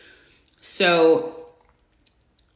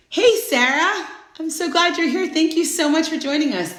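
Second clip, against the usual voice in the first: excited greeting; 9 semitones higher than usual. Real, not acted. Greeting a viewer joining live.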